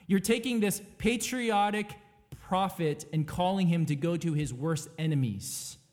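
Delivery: usual speaking voice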